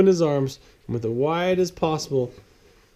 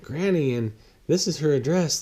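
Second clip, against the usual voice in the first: sheepish Leroy; 6 semitones higher than usual. Acted. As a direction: explain an odd request with a nervous lilt. Leroy explains to James.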